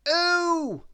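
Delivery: high, slow moaning character voice; high, slow moan over sore feet; moaning Mister Clumsy. A narrator's reading. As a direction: groan it out in a high comic voice. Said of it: "he moaned".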